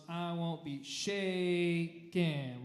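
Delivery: slow, each word punched; accented words. Shown as a demonstration. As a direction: lean hard on every word. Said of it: "really intentional on those".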